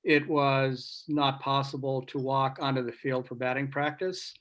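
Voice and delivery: ordinary talk, nothing marked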